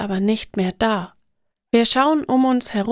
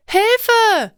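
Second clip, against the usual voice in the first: much higher, louder scream for help; cry for help; 12 semitones higher, 5 dB louder than usual. A narrator's reading. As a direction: yell out after falling in a hole. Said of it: "schreie ich". (lauter Hilferuf, viel höher)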